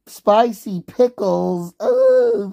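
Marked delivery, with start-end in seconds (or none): 1.8-2.5 very high excited ooh; light up at the idea; delighted ooh. Real, not acted. Streamer reacting to spicy pickles, excited.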